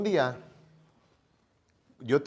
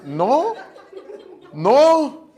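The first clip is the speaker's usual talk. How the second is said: higher, louder firm refusal; insistent mother; 7 semitones higher, 7 dB louder, slower than usual. Retold or imitated. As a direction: lay down the law. Mamá insiste, él nervioso. (mamá firme, más aguda y fuerte)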